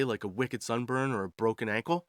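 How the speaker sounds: normal talking voice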